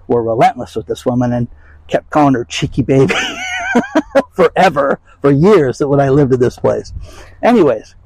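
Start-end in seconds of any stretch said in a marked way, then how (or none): 3.2-4.3 sudden high burst of laughter. Real, not acted. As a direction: crack up at your own story. Laughing at the cheeky story.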